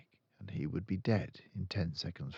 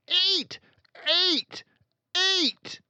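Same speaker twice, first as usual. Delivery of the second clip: very high, loud repeated yells; yelling; 10 dB louder, much slower than usual. A narrator's reading. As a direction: hammer the word three times. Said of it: "the Trunchbull was yelling".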